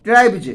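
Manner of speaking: ट्राइब्स ending in a voiced "j"; final j sound. Shown as a demonstration. Teacher shows students how ट्राइब्स is read, final s turned to j. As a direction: say ट्राइब्स as "traib-j", not with an s. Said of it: "इस एस को हम लोग ज पढ़ते हैं".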